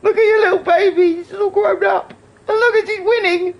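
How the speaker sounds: high-pitched gushing, close to tears of laughter; proud cooing pitched right up; overcome gushing. Real, not acted. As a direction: gush in a high, choked-up squeal. Gushing over friend's winning car.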